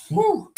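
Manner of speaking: short, very high whoop; high "woo" whoop. Real, not acted. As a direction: let out a high-pitched woo. Excited about the burger.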